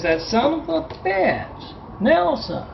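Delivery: high, strained mock yell of an outraged man; angry yokel. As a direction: squeal it out, indignant. Angry hick recognises himself.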